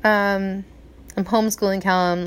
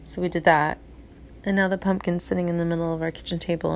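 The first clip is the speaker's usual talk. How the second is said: ordinary delivery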